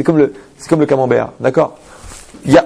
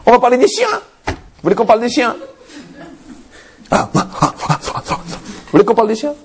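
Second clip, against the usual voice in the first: higher, sarcastic with mock panting; mocking taunt; 8 semitones higher than usual. Rabbi mocking the mistress, panting like a dog. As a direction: taunt her like talking to a dog.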